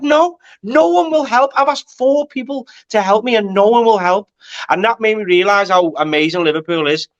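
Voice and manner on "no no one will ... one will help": reliving the frustration of being refused; retold desperate plea. Retelling to friends how he begged strangers, higher pleading. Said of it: "I said".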